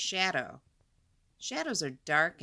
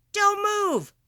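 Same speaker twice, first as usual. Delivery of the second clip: loud, high sharp cry of warning; urgent warning; 14 semitones higher, 9 dB louder than usual. Acted. Teacher acting out a quicksand warning, loud and urgent. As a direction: stop them in their tracks.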